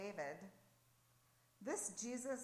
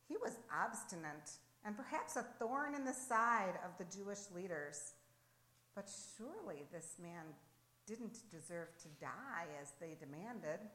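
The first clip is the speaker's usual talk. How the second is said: usual speaking voice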